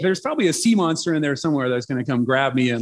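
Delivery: ordinary talk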